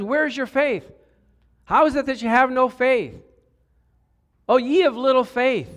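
high, loud reproach; stern rebuke. Acted. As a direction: preach it as a forceful scolding. Jesus rebuking his disciples.

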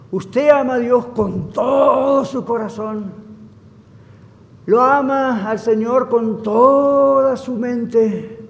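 emphatic probing pastor, high and drawn out; pointed questioning. Retold or imitated. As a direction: press the question, drawing out "todo" and "toda". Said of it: "preguntas que el pastor le hizo".

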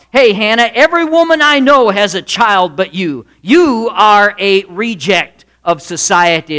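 loud, accusing voice of social pressure, slowing on the verdict; Peninnah. Acted. Culture shaming the childless woman, loud accusation. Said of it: "Peninnah's voice represents the culture".

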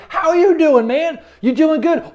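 high, upbeat hello; cheery, high-pitched welcome; hearty greeting. Acted. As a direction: greet a buddy with lifted pitch. Acts out greeting people at church.

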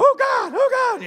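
very high, loud imitation of panicked prayer; mock crying out. Preacher imitating passengers praying in turbulence, high loud cries. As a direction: cry out like frightened passengers. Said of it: "people are crying out to God".

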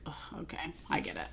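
whispered, barely there "Oh, okay"; soft unvoiced "I get it"; whispered realization. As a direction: breathe it out as a quiet aside. Voicing Will's realization.

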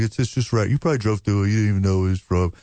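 ordinary talk, nothing marked

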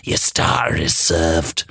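low, slow, mocking; drawn out low and mocking. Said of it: "said a now solitary mocking voice".

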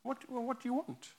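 high, soft open question; receptive listener. Acted. Jesus hearing the mother's request, gently receptive. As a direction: ask it softly, pitched well up. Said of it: "he hears the request of the mother".

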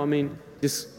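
ordinary talk, nothing marked

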